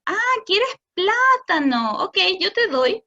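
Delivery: cheerful model. Shown as a demonstration. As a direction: brightly hand the fruit over. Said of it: "Lo que el adulto debería hacer es decir".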